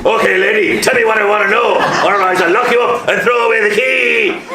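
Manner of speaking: high, loud hard-boiled TV detective; loud, high tough-cop interrogation growl. Acted. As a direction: grill her like a tough telly copper. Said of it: "that's the telly for you".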